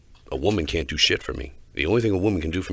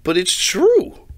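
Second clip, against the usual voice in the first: slow, much higher and louder insistence; drawn-out, pitched-up but it's true; emphatic insistence; 8 dB louder, much slower than usual. Real, not acted. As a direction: hammer home that it's true. Insisting the meme is right.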